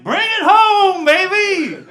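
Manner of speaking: high, loud and slow; excited cheer. Retold or imitated. Urging his finger on with the find, excited cheer. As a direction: cheer the winning play home.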